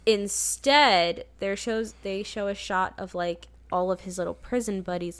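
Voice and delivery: ordinary delivery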